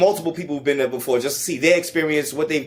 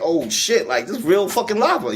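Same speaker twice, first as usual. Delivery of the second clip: retold amazement; 7 semitones higher than usual. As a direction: pitch jumps higher, excited disbelief. Retelling awe at seeing lava.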